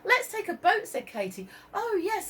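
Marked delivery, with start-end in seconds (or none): 0.0-0.9 put-on excited girl. Katie excited. 1.7-2.3 delighted pickers. Acted. Olive pickers giggling.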